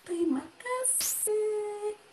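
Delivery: high, very slow thank-you; mock-dainty, stretched politeness; gentle, refined talker. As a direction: imitate a sweetly delicate friend. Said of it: "tutur katanya lembut".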